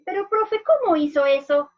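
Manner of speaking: put-on a small child interrupting the story; curious child. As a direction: pupil asking the teacher, puzzled. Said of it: "palabra y dice".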